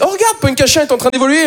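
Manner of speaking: high-pitched amazed commentary; mock excited onlooker. Onlooker watching a wild Pokémon evolve, high and mock-amazed. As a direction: marvel at it like a game event.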